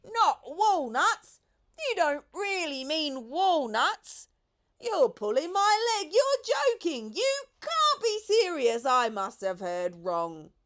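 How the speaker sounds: high, loud, slow disbelieving monkey; a monkey beside himself with joy; squealing disbelief; ecstatic monkey. A narrator's reading.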